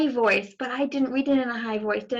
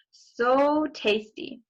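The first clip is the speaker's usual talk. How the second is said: slow, relishing purr; slow satisfied wolf; savouring; 3 semitones higher, much slower than usual. Acted. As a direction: drag it out, savouring the meal. Wolf savouring his meal.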